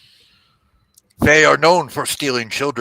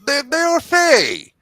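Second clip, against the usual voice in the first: shouted, pitched-up rebuke; angry priest; 13 semitones higher, 5 dB louder than usual. Acted. Priest rebukes the knight for questioning the fey, angry shout. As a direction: scold the knight for listening to demons.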